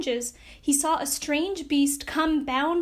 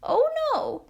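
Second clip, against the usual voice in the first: higher reassuring Scarecrow; declaring; 10 semitones higher than usual. Acted. Scarecrow reassuring the mouse queen, declaring. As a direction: wave the worry away. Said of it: "declared the Scarecrow".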